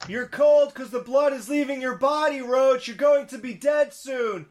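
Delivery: high, shouted mock tirade; acted movie line. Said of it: "Go ahead, somebody do the line".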